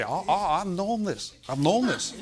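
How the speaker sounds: put-on caricatured Korean-accented English; mock Korean accent, knowing reply; imitated Korean accent. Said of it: "South Korean man".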